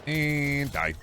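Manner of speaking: a brief slip into an impression of an actor's voice; lightly put-on actor voice for two short lines; Patrick Warburton impression. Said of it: "You slipped into Patrick Warburton there for a second?".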